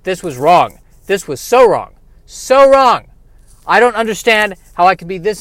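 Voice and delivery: hammed-up loud lament; pained regret. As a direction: lament your mistake, rising in pitch and volume. Injured hero repents melodramatically.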